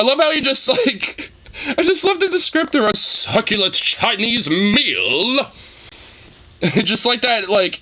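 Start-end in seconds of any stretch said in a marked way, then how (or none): 2.9-5.5 slow, savored phrase with "meal" stretched out; prolonged MEAL. Retold or imitated. Relishing the clip's descriptor, prolonged emphasis on "meal". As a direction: draw out "meal" in a mock-theatrical imitation. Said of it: "the prolonged emphasis on meal".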